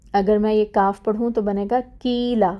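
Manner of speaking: ordinary talk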